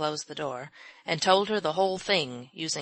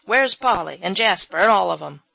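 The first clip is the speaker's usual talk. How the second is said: loud, demanding call; loud exclamation; 10 dB louder than usual. A narrator's reading. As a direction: call for everybody at once. Old man exclaims.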